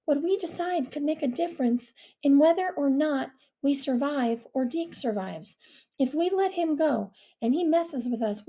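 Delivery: put-on girl voice, worried. Mia voiced.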